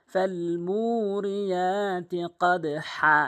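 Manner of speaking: very slow, long "মুরিয়াতি", pressed "হা"; elongated model reading; correct recitation. Shown as a demonstration. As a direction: chant "ফাল মুরিয়াতি কদ হা" with stretched vowels. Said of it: "এভাবে উচ্চারণ করবেন".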